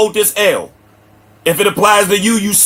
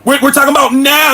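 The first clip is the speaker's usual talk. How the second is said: ordinary talk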